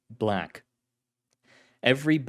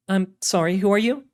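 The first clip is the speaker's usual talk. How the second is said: female agent voice; 9 semitones higher, 5 dB louder than usual. Acted. Carlotta voiced by narrator.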